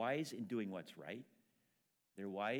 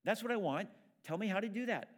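higher, louder, impatient pleading; eager demand; 8 semitones higher, 5 dB louder than usual. Retold or imitated. A believer demanding instructions from God, loud and eager. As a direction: beg for the answer like an eager student. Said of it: "Our first instinct is to ask".

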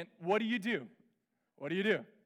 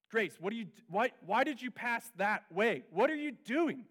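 higher, louder nagging; irritated chirping; 4 semitones higher, 5 dB louder than usual. Retold or imitated. Father nags daughter at scrimmage, irritated. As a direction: needle her like a frustrated coach-dad. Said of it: "I found myself just chirping at her".

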